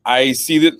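ordinary delivery

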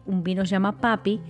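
ordinary talk

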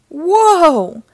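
loud, high, drawn-out whoa; startled cry. A narrator's reading. Ranger slipping from the sculpture, startled loud cry. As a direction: yell as if suddenly losing your footing.

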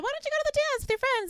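high-pitched mother voice; a lilting mum asking nicely; mother's voice. Retold or imitated.

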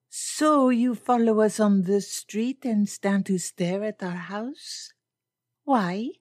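lilting foreign lady pressing politely for an answer; poised foreign lady. Acted.

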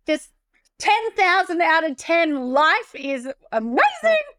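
high, slow, hammed-up rapture; mock gushing. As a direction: stretch it out in exaggerated, over-the-top bliss. Hammed-up picture of perfect life.

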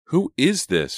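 high, loud indignant demand; angry demand. A narrator's reading. Girl confronting the anonymous caller, angry demand. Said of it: "she demanded angrily".

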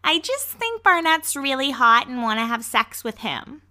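high, put-on girly quote; imitating Jessica. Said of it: "she's talking to Mark and she's like".

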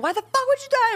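high-pitched reproach, not really crying; retold upset outburst. Sister demanding an explanation, imitated upset outburst. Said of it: "and she was bawling".

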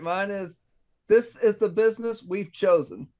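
ordinary talk

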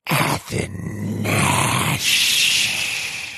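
slow, low unvoiced growl. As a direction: growl the name out like a beast. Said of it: "in a growling voice".

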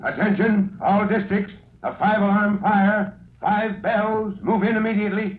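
rally every station at once; urgent dispatch. Acted. Fire alarm dispatch to districts.

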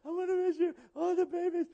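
high, soft, sentimental gushing; mock gushing well-wishers. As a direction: coo over the couple like teary church ladies. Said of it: "people crying".

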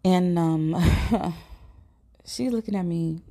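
ordinary talk

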